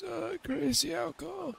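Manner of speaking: high, soft boozy voice; woozy from the drink; giggly drunk. Pretend. Pretend drunk in game.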